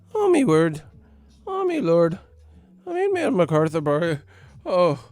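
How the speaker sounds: high, exaggerated put-on Irish accent. Tripping comedian stuck in Conor McGregor act, high mock Irish accent. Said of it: "had me with an Irish accent".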